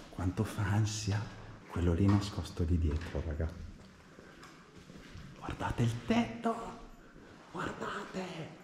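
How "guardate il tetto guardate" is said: high, awestruck exclaiming; amazed. Real, not acted. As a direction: gasp in wonder at the roof. Amazed by the ceiling.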